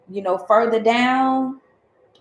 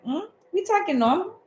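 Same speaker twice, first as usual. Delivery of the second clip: innocent, puzzled reply, much higher; mock-innocent confusion; 7 semitones higher than usual. Friends answering the confused guy, mock-innocent and puzzled. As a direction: play dumb, pitch jumping up on normal. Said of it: "And we like".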